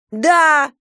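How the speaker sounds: high, loud defiant yell. A narrator's reading. Girl yelling at grandmother about sport, defiant yell. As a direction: shout it back at them. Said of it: "заорала я".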